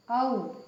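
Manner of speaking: औ glided from अ into ऊ; demo vowel औ. Shown as a demonstration. Teacher showing students the औ glide, open mouth. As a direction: slide अ into ऊ for औ. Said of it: "अ और ऊ का साउंड निकलता है".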